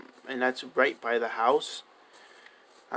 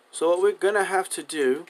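slightly higher, accidental British accent; 4 semitones higher than usual. Real, not acted. Player explaining the next step, slipping into British accent. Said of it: "going for a British accent".

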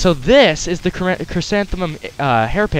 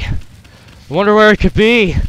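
slow, louder taunting voice; sly villain voice; 4 semitones higher, 5 dB louder than usual. Acted. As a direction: drag it out to mock them. Same line redone in voice.

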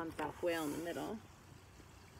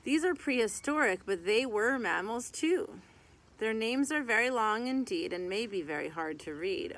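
lively sing-song rhyme reading; reading to children; 5 semitones higher, 6 dB louder than usual. Acted. Reading a mammal picture book to kids, lively sing-song. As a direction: read the rhyming verse out to little kids.

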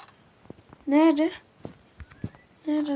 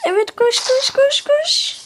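rising higher with each koş; excited urging; 10 semitones higher, slower than usual. Real, not acted. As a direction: push them on, climbing higher. Urging friend to run.

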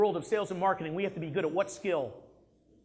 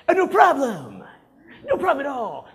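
higher, louder breezy no problem; fake-confident salesperson; 7 semitones higher, 9 dB louder than usual. Shown as a demonstration. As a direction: brush it off with forced cheer. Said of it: "That would be acting confident".

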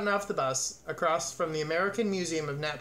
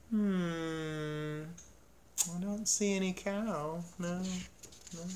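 pondering; 6 dB quieter, slower than usual. A narrator's reading. Guard looks around for the cow, musing quietly. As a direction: muse it softly with long pauses.

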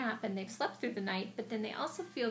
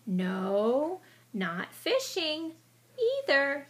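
teasing denial; 5 semitones higher, 6 dB louder, much slower than usual. Acted. Mole denying playfully.

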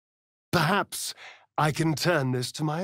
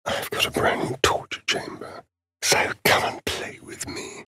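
whispered taunt. Acted.

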